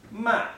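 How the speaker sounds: "Ma" loud, high and dropping fast; short, emphatic falling "Ma"; fourth tone. Shown as a demonstration. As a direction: punch "Ma" out high and let it fall quickly. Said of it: "demonstrate coming down like this".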